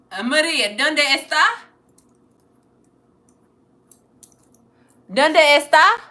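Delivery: calling out loud in halting Spanish; loud beginner Spanish, asked of a viewer named Maria. Real, not acted. Calling question again.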